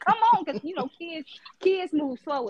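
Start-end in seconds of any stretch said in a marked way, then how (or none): 0.0-0.4 impatient hurry-up. Retold or imitated. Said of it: "So I'm like".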